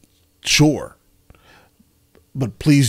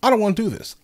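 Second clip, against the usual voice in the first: exasperated; 11 semitones higher, faster than usual. Real, not acted. Giving up on the game.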